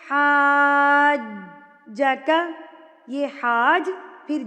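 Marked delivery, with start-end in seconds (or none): 0.1-2.3 حاجک with the first vowel held very long, four counts; long madd. Shown as a demonstration. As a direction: stretch the ا in حاجک slowly. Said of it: "تو پڑھیں گے ہم اس کو". (حاجک میں مد کو چار حرکات کھینچیں)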